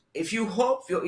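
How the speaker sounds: ordinary talk, nothing marked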